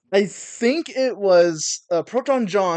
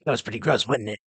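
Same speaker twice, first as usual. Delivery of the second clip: a hint of a creepy villain; Imperfect Cell; 3 semitones lower, 3 dB quieter than usual. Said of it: "for the, uh, chairman".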